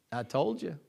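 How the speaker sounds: hushed, low-volume mutter; soft mock stage aside; conspiratorial aside. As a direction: slip it quietly to an accomplice. Mother's aside to her daughter.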